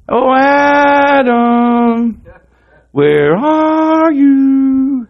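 long, drawn-out high call; God calling loudly for someone hiding, every vowel stretched. Acted.

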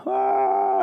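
high, loud mock wail; imitated wailing. Preacher imitates the men at the altar, wailing. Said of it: "they start wailing".

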